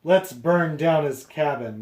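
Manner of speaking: rally the angry crowd; storybook mob crying out, lightly acted. A narrator's reading. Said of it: "they cried".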